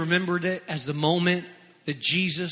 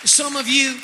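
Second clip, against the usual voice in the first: raised, ringing preacher voice, building on faith; fervent preaching; 8 semitones higher than usual. Acted. Preacher rousing the church about bold faith, fervent. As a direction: preach it out to the congregation.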